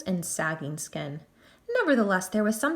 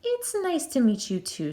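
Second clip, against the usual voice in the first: stiffly; 5 semitones higher than usual. A narrator's reading. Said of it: "said Missus Grimm stiffly".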